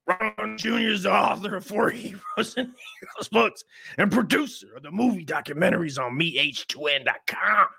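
high, mocking voice reading a caption. Mocking his rival's caption in a high voice. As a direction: sneer it out in a high put-on voice.

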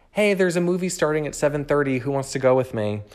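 high, loud, quick call-out to friends; re-enacted excited group message; eager invitation. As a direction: act out an impulsive, excited invite. Acts out spontaneous invite to friends.

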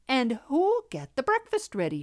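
bright little hen. A narrator's reading. Little red hen asking about breakfast, bright. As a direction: call out the question brightly. Cheerful hen asks again for help.

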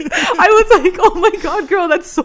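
high, laughing disbelief. Real, not acted. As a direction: laugh through the words. Laughing reaction to Ethel.

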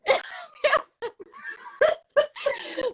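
long, high peal of laughter; high, uncontrollable giggling fit; helpless laughing. Real, not acted. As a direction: keep laughing till it squeals. Laughing at her own story.

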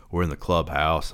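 plain reading voice

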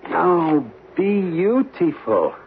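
slow, drawn-out wonder; admiring. Acted. Photographer admiring the moonlit temple, slow wonder. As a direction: savour the sight of it.

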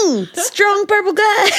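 high, laughing. Real, not acted. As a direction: gasp it out laughing. Laughing at joke.

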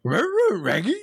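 a dad's old party trick for his kids, cartoon dog calling his pal. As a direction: yelp it like a goofy cartoon dog. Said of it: "That was perfect".

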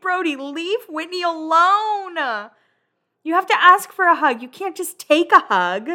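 mock-stern telling-off aimed at the screen; playful scolding. Real, not acted. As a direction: tell him off, half joking. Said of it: "scolding Brody at the screen".

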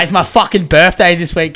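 normal talking voice

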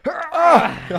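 high, loud hammed-up groan before fainting; a pretend faint-worthy ugh; mock pained groan. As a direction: groan like you're about to keel over. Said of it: "Just like".

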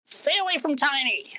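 high-pitched warning to back off; protective warning. Acted. GM voices Zorg defending Tiny.

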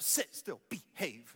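low, soft parental hiss in church; quiet strict parent voice in a pew; hushed parent scolding. Retold or imitated. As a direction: scold a toddler under your breath. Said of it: "sitting in church all dressed up".

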